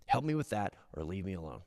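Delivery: low, soft insistence on being free; quiet defiant voice. Retold or imitated. Men refusing to surrender, low and quiet. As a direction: mutter it like a stubborn man. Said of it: "Men, I'm talking to you".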